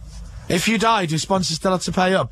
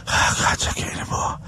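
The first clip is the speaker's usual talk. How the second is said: breathless whisper. Retold or imitated. Imagining exhaustion on the mountain.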